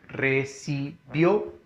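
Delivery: RECIBIÓNOS slowly split into re-ci-bió-nos; recibiónos with a gap after each syllable. Shown as a demonstration. Teacher repeating the example for the class, slow syllable by syllable.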